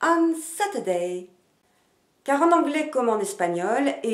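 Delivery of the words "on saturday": Saturday accented on "Sat", said slowly in English; slow On Saturday, SAT-ur-day; stress on first syllable. Shown as a demonstration. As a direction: hit the start of Saturday and let it drop. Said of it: "l'accent sur la première syllabe du mot".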